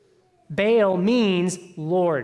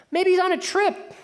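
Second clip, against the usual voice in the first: much higher, teasing sing-song; mocking trash talk; 11 semitones higher than usual. Elijah taunting the prophets of Baal, high mocking voice. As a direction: needle them with a high mock voice. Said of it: "Elijah starts talking trash".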